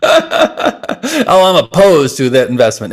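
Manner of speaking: a light, high-pitched jokey voice of a reluctant attendee; mock objector. Teacher imitates a workshop attendee who refuses, light mock voice. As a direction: playfully mimic the grumbling holdout.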